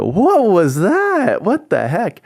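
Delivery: high, loud, drawn-out disbelief; incredulous. Retold or imitated. Storyteller reacting to a limp handshake, incredulous.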